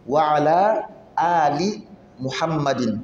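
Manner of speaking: "Wa ala ali Muhammadin" with the long "laa" drawn out, slowly; correct long vowels. Shown as a demonstration.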